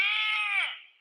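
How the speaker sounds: sudden high loud "Ah!" yell. Retold or imitated. Imitating the co-star's wordless yell through the door. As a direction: shout it out like a grunt of acknowledgement. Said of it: "you just went like".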